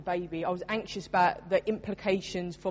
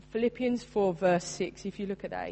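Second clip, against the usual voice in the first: ordinary talk, nothing marked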